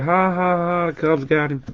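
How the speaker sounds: high, soft mock laugh for the card's player; lightly voiced teasing ha ha ha. Mocking Perez on card.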